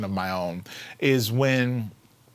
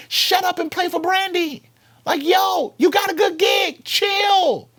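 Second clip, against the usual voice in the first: high, loud mock scolding; naysayers' shout; 5 dB louder than usual. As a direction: yell it like an exasperated doubter, pitch way up.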